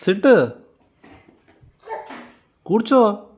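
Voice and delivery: loud, high-pitched order to sit; stern command. Real, not acted. Parent telling a crying child to sit, stern command.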